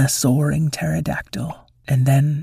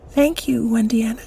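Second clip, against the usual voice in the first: high, whispered tiny voice; a shy little bird; little chicken; 8 semitones higher than usual. Acted. The little chicken thanks Wendiana, high and whispered. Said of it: "said the little chicken".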